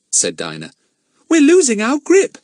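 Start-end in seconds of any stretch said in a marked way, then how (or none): 1.3-2.4 put-on high, loud girl's voice, outraged; indignant girl. A narrator's reading. Dinah finding her voice first, high and indignant. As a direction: Dinah bursting out, scandalised.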